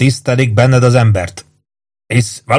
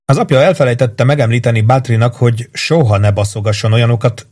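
plain reading voice

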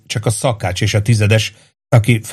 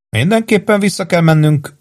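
quick, high-pitched interjection; hasty insistence, pitched up; hurried; 9 semitones higher than usual. A narrator's reading. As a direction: cut in hastily. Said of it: "mondta gyorsan Péntek".

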